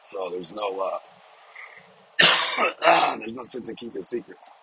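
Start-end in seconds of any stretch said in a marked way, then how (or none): 2.2-3.1 real sudden cough; smoker's cough. Real, not acted. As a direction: cough mid-sentence. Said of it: "I have a little bit of a smoker's cough".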